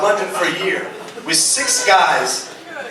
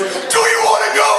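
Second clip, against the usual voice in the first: aggressive fan; 13 semitones higher than usual. Retold or imitated. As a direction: bark it like a drunk football fan itching to fight. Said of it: "He goes".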